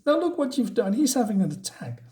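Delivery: high-pitched mock alarm; a wife scolding police over her husband's attack; alarmed reproach. High-pitched alarm. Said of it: "and he says".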